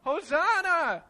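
high, loud, drawn-out hosanna; alarm clock shouting. Shown as a demonstration. Showing the kids the alarm clock again, loud and high. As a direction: bellow it like a blaring alarm. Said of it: "You wanna try it?".

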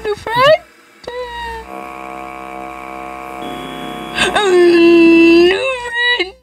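high shaky whimpering voice; lingering fright. Real, not acted. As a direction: stay small and spooked. Still frightened, voice kept high.

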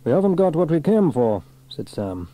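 a hint of the steady hero; Fireman Sam, resolute. A narrator's reading. Sam refusing to go back down yet, lightly resolute.

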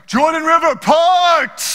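high, loud mock prophet command; grand command. Theudas commanding the Jordan River, hammed-up loud shout. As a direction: order the river like a would-be Moses. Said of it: "he's like".

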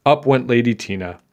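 usual speaking voice

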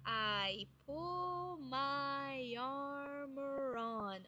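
very slow, each link stretched out; slow it right down to show the joins. Shown as a demonstration. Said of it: "Olhem as emendas". (bem devagar mostrando as emendas)

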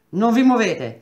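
ordine urlato, acuto e forte; grido. A narrator's reading. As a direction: urla l'altolà a voce alta. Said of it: "gridò il delegato". (comando gridato)

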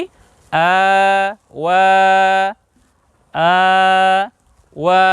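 আ and ওয়া each held long; sustained reading-lesson vowels in আ, ওয়া; lengthened vowels. Shown as a demonstration. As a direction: draw out আ, then ওয়া, separately. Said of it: "আমরা উচ্চারণ করব কি".

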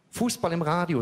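lightly voicing the girlfriend. Girlfriend quoted in comic story.

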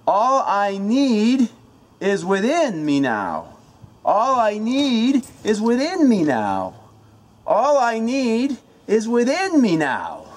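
loud, slow incantation with "within" punched high; shout, stress on within. Shown as a demonstration. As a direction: drive hard into "within", then drop off on the rest. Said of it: "the emphasis of each word".

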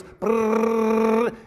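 a long, buzzing "brrr" like an idling chainsaw; chainsaw sound imitation. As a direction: hold the buzz like a weak motor. Imitating chainsaw that won't run.

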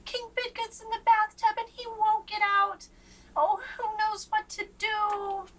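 high, loud fretful page voice; young page fretting about the king; worried page. A narrator's reading. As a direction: plead for help, anxious and high. Page's worried plea.

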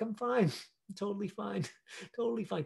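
forced laughs between the words; nervous laughing. Shown as a demonstration. Actor shows hiding panic, with nervous laughs. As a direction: laugh it off, too much. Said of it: "I can say this line".

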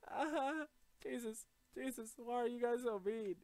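high, loud hammed-up crying plea; mock sobbing apology. Gamer begging mean attackers, high hammy sobbing. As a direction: wail and beg like a bawling child.

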